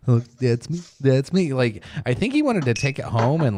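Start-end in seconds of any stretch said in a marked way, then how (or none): 0.1-1.5 mimicking childlike delight; a little higher and louder, proudly thrilled; Steve Harvey. Retelling a TV host seeing his Lego likeness, delighted imitation. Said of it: "he had that little glimmer in his eye".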